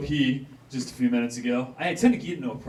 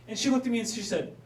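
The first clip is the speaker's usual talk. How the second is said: ordinary talk, nothing marked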